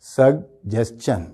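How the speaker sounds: "سجیشن" very slowly as sug... jes... chun; correct pronunciation. Shown as a demonstration. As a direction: sound G and CH in "سجیشن", in pieces. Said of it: "صحیح اس کا pronunciation کیا ہے؟". ("سجیشن" کو "سگ جیس چن" توڑ کر)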